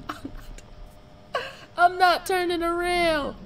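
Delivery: half laughing, half crying in fear; terrified whimper. Real, not acted. Terrified, near tears.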